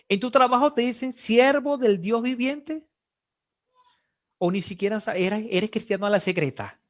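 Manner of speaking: ordinary talk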